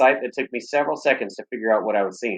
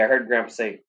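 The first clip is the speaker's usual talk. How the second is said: ordinary delivery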